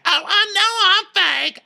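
very high squeaky comeback; mock squabble. Childish fight about being fake, squeaky mock defiance. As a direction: fire back in a very high voice.

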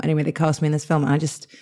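ordinary delivery

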